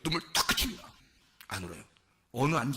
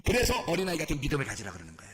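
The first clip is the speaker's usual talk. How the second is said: ordinary talk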